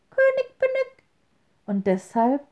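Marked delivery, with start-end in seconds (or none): 0.1-1.0 very high piping call; high thin wren voice; wren's cry. A narrator's reading. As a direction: chirp it from the hedge. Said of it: "ruft er wohl zuweilen".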